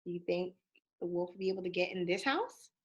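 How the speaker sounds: plain reading voice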